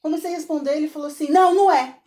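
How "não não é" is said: louder, higher curt no; imitating the professor cutting him off sharply; curt professor reply. Professor answers curtly in story.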